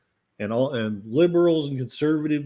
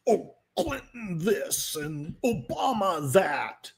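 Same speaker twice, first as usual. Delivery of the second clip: slow, higher sing-song mockery; mimicking critics, higher and drawn out; mocking quote; 5 semitones higher, slower than usual. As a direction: parody the endless complaining.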